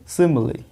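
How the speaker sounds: सिमिली with short "i" sounds; model pronunciation. Shown as a demonstration. Teacher shows students the word, carefully pronounced सिमिली. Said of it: "स पे छोटी की मात्रा".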